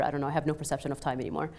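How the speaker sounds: ordinary delivery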